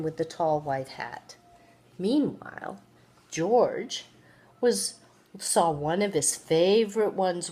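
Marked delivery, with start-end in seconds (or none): none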